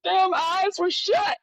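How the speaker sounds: high, loud, amazed reply to Morpheus; answering Morpheus in a high, thrilled voice; excited exclaim. Retold or imitated. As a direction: squeal it like a revelation just hit. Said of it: "I'm like".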